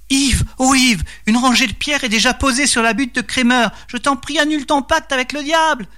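high-pitched pleading wife; wife's cries. A narrator's reading. Wife waking husband to beg him, high alarmed cry. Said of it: "réveillé par les cris de Marie".

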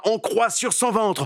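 plain reading voice

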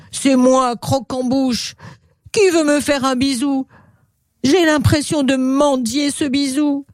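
high, pleading crocodile voice; big hoarse voice. Acted. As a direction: beg in a put-on rough crocodile voice. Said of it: "Une grosse voix rauque retentit". (grosse voix rauque et suppliante, plutôt aiguë)

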